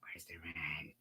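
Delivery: villain demanding rent in a whisper. Acted. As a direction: hiss the demand like a melodrama villain. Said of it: "act out the whole live stream in different voices".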